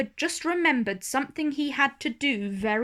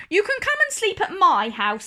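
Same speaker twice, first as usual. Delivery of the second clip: higher, louder, fast offer; Little Miss Sunshine; 8 semitones higher, 5 dB louder, faster than usual. A narrator's reading. Little Miss Sunshine offers Mister Lazy her home, high and quick. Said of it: "said Little Miss Sunshine".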